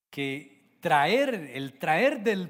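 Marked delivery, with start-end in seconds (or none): none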